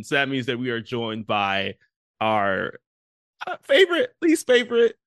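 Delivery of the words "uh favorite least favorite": sarcastic. Acted. Mock correction about guest.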